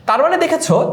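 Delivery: ordinary talk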